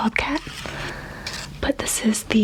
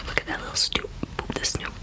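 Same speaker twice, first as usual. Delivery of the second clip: cooing whisper; 9 dB quieter, much faster than usual. Real, not acted. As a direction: coo it to a cute pup. Cooing at wolf picture.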